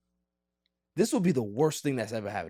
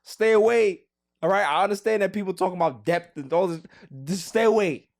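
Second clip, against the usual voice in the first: higher, louder insistence; urgent plea; 11 semitones higher, 7 dB louder than usual. Real, not acted. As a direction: warn him off, pleading. Begging player to avoid Lakers.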